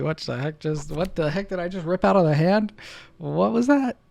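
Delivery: can't believe what just came out; stunned disbelief. Real, not acted. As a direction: climb into a disbelieving yelp. Shocked at ripped card.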